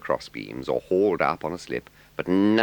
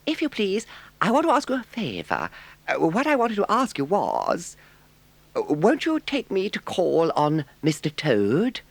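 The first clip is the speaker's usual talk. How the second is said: high, timid little mole voice; a much higher, eager little animal; 3 dB louder than usual. A narrator's reading. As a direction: ask shyly in a small high voice. Mole's lines in story.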